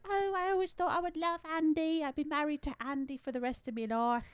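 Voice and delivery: high-pitched wistful British mother; imitated British mom. Imitating the mom recalling Andy, high British voice. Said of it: "they cut to the mom".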